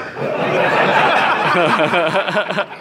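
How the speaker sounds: high, loud peal of laughter; burst of laughter. Real, not acted. Reader laughing at own pun, high loud laughter. Laughing fit during reading.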